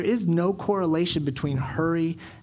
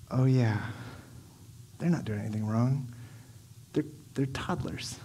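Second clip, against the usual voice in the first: low, hesitant admission, trailing softer; dawning realization; 3 semitones lower, 6 dB quieter than usual. Acted. Dad realizing his kids did nothing wrong, quietly sheepish. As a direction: let it sink in with long pauses.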